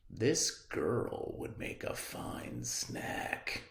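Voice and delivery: slow, soft whispered scheming; wolf's private thought. Acted. Wolf plotting to himself, slow sly whisper. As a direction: hiss it slowly to yourself. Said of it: "he thought to himself".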